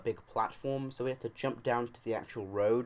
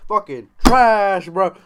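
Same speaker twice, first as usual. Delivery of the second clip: slow, much louder and higher yell; 8 semitones higher, 15 dB louder, slower than usual. Shown as a demonstration. Ranting about Dragon Ball, slow full yell. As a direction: bellow it out slowly.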